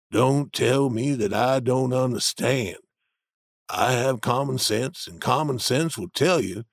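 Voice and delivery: low steady Jim arguing his point; Jim's voice. A narrator's reading. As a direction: voice the older man, grounded and sure. Jim insists.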